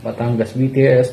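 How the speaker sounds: ordinary talk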